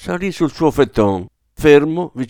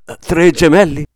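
louder, higher startled query; incredulous question; 6 semitones higher, 9 dB louder than usual. A narrator's reading. Father questioning the doctor about triplets, incredulous. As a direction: blurt it out in disbelief.

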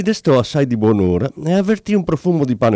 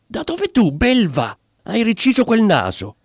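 much higher, furious wife yelling at her husband; angry shout; 10 semitones higher than usual. Acted. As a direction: scream it at him in outrage. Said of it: "gridò con ira". (moglie furiosa che grida al marito)

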